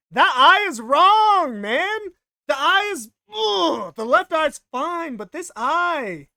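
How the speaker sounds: loud, high, drawn-out wail of annoyance; exasperated high-pitched yelling; frustrated outburst. Real, not acted. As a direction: cry out in exasperation at your own mistake. Frustrated at own drawing mistake.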